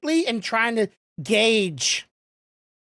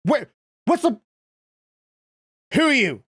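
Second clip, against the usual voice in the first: startled warrior. Acted. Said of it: "the warrior's going".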